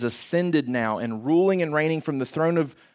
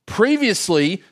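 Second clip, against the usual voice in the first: ordinary delivery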